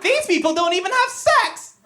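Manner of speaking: high-pitched movie-character impression; Sister Act monologue. Boy recites Sister Act for mom's friends, high dramatic imitation. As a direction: recite a film rant in a put-on high voice. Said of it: "recite monologues from Sister Act".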